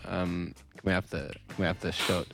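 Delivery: retold awkward request. Retold timid request to waiter.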